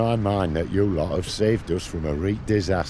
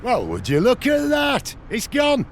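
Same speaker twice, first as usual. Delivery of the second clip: pitched way up, calling out in amazement; amazed shout; 13 semitones higher than usual. Acted. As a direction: shout in delighted disbelief. Flames vanish before villagers.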